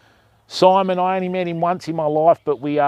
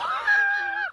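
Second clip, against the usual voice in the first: high laugh; 8 dB quieter than usual. Real, not acted. Laugh after dog moment.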